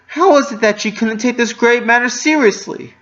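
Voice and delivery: plain reading voice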